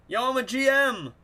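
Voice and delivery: high, loud bragging shout; excited brag. Real, not acted.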